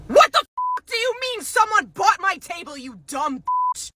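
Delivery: high-pitched enraged screech; yelling customer. Acted. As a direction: shriek in outrage at the clerk. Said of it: "I'm about to start yelling".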